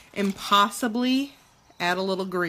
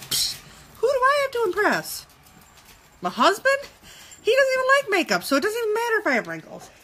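sassy scoff; 11 semitones higher, faster than usual. Real, not acted. Mocking rhetorical question.

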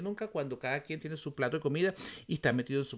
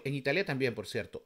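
ordinary delivery

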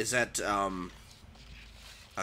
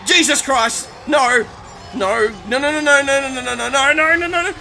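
high-pitched, screaming panic; real freak-out; 14 semitones higher, 13 dB louder than usual. Real, not acted. Gamer attacked after running away, screaming in panic. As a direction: shriek a rapid string of refusals, terrified. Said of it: "I'm freaking out already".

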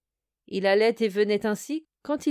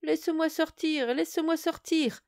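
muffled voice; 8 semitones higher than usual. Acted. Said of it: "appeler d'une voix très étouffée".

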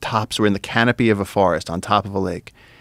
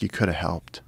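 muttered reproach; 7 dB quieter than usual. Retold or imitated. Telling JJ he could have helped, said softly. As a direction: quietly chide him. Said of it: "and I was like".

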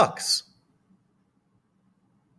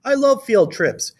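higher-pitched schoolchild voice; storybook child piping up, higher; Charlie; 8 semitones higher, 3 dB louder than usual. A narrator's reading. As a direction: voice an eager pupil, pitched up. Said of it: "said Charlie".

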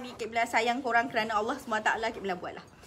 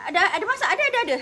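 high, louder, rushed fib; nervous fib; 7 semitones higher, 6 dB louder, much faster than usual. Retold or imitated. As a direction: blurt it out to cover the lie. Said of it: "Saya bohong ayah saya". (bohong gugup, suara tinggi dan laju)

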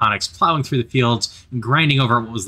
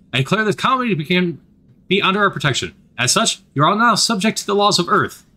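booming decree; 8 semitones higher than usual. A narrator's reading. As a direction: declare it like a conquering officer. Commander's decree.

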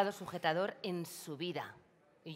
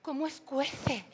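pained wince; 8 semitones higher, slower than usual. Acted. Sting burning.

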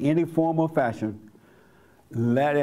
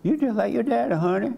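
much higher, sweetly teasing wife; playful endearment; 10 semitones higher than usual. Shown as a demonstration. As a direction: tease a spouse in a high, affectionate lilt. Repeats the remark as a wife.

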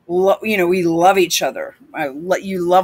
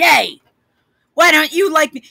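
lightly mimicking a needy dog petter, higher; a louder, higher mock "why me?" appeal; mock pleading; 6 semitones higher, 4 dB louder than usual. Mimics Biden coaxing the dog.

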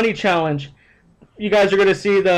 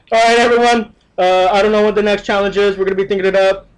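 ordinary talk

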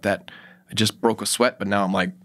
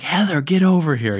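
higher, louder urgent call; husband yelling for his wife in the hotel room, urgent; retold yell; 7 semitones higher, 3 dB louder than usual. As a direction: call her over in alarm. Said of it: "And I yell".

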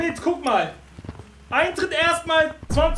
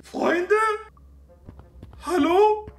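calling from far away; 6 semitones higher, slower than usual. Pretend. Alone in virtual world, searching friends.

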